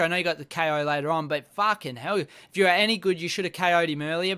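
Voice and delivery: usual speaking voice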